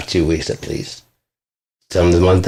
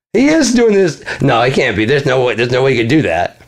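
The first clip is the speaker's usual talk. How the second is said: high, anxious self-questioning; high paranoid victim voice; 6 semitones higher than usual. Retold or imitated. Mocking the victim's paranoia about the birds, high and hammed-up. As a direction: play the jumpy cursed man talking himself down.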